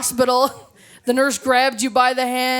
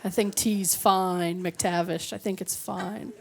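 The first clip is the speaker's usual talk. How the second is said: soft and slow, lower, lightly voiced; a laid-back Canadian shrug of a voice; the comic voices a placid customer from the ad, soft and slow; mock mellow customer; 4 semitones lower, 7 dB quieter, slower than usual. Voicing a calm Canadian customer.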